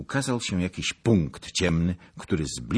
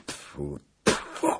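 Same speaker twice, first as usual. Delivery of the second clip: voiceless mock spit, twice; spitting in disgust. A narrator's reading. Zagloba curses his own folly, disgusted spitting. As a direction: spit out "tfu" with puffed air.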